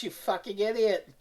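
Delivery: ordinary delivery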